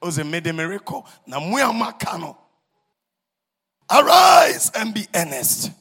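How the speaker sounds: thundering preacher's summons; fiery exhortation. Acted. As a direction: rouse the saints to work. Preaching at full force.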